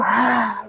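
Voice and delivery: long soft sigh out; audible exhale sigh. Shown as a demonstration. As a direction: let the breath fall out with a sigh. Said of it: "as you exhale".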